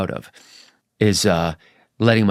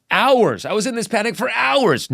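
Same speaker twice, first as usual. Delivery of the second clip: higher mock-dramatic voice of a panicked patient; people's dramatic claim; 12 semitones higher than usual. Telling the host what panic patients claim, in a higher mock-dramatic voice. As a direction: overplay how they insist it lasted hours. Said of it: "How long does it last?".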